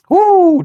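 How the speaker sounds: high, loud whoo of disbelief. Real, not acted. Vlogger reacting to the crazy story with a loud whoo.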